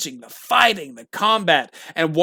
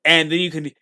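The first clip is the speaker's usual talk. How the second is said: ordinary delivery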